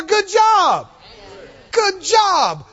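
high, loud congratulating shout; rejoicing praise. Acted. Jesus congratulating the healed woman, jubilant shout. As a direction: cheer her on at full voice. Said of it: "so he can rejoice with her".

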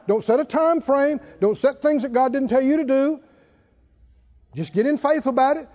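usual speaking voice

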